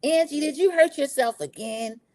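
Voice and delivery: high, put-on voice of faint reproach; mock her tactless question; mimicking Mary. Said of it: "I don't know if I would have phrased it like this".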